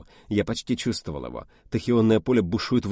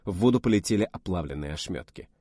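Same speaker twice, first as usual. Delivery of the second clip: ordinary delivery, nothing marked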